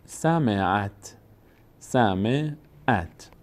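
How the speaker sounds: "سمعت" very slowly, closing on a joined final "عت"; slow reading demo. Shown as a demonstration. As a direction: stretch out "سمعت" syllable by syllable. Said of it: "باید فتحه رو بچسبونیم به ت". («سمعت» آهسته و کشیده، هجا به هجا)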